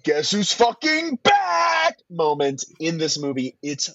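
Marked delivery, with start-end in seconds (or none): 0.0-2.0 triumphant announcement. Retold or imitated.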